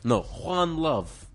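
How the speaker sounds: put-on slow, high Serbian accent, Juan Love drawn out as a correction. Co-host correcting his own take, slow Serbian accent. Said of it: "I do the accent".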